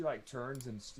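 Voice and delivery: ordinary talk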